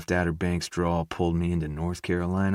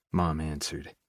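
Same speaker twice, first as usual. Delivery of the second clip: plain reading voice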